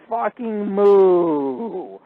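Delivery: loud, high, long drawn-out mooing yell; yelled cow sounds. Retold or imitated. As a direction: holler it like a cow, stretching the moo way out. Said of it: "I yelled out".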